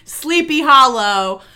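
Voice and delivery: slow, drawn-out dramatic intro; dramatic narrator. Retold or imitated. Elders set the scene in a slow dramatic storyteller voice. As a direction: announce the setting like an old storyteller. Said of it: "They're like, Picture it".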